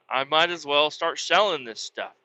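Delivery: ordinary delivery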